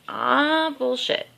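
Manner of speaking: lightly voiced dad brushing it off; imitating dad. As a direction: toss off "Ah, bullshit." like dad did. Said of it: "That's what he would say".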